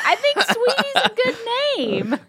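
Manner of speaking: very high, laughing mock protest; hammed-up high pleading voice; high-pitched protest. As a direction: squeak out a playful objection through laughter. Retelling her protest about a name.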